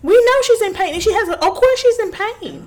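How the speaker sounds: fired up and incredulous; retelling the vet visit, pitched high and loud in outrage; indignant rant. Real, not acted. Ranting about the dog owner.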